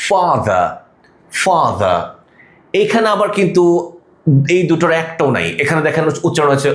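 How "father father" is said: "father" with drawn-out "aa", no final r; long AA vowel. Shown as a demonstration. Teacher demonstrating the long vowel in "father" as "aa". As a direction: say "father" as "faa-dha". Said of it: "long vowel sound আ".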